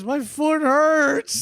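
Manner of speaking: imitating hurt brother. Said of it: "He goes".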